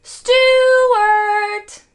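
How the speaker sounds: long, high, loud sung-out call. A narrator's reading. Family calling Stuart into the mouse hole, loud drawn-out call. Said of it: "Then they all called".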